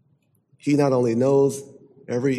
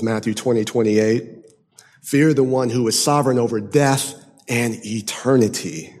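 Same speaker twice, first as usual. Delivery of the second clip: ordinary talk, nothing marked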